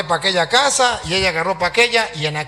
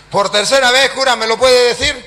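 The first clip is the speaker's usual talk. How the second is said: louder, higher mock interrogation; raised-voice retold demand; insistent challenge; 5 semitones higher, 5 dB louder than usual. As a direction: challenge him publicly, imitating the pastor. Acts pastor's public challenge.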